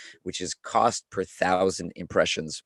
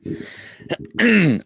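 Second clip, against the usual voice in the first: hard throat clear; 11 semitones higher, 4 dB louder than usual. Real, not acted. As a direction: clear the hoarse throat loudly. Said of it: "I can do this".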